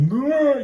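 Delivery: loud high playful growl; mock growl. Imitating grandma scaring the kids, loud mock growl.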